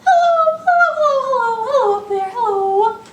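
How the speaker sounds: squeaky sky-high hellos; high calling hellos up to the ceiling. Shown as a demonstration. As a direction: reach up to the top of your voice. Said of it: "Let's say hello as high as we can".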